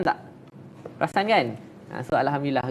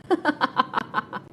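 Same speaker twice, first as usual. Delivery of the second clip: a short high giggle; quick amused giggle; high light laugh; 14 semitones higher than usual. Real, not acted. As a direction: laugh at your own story. Laughs at own story.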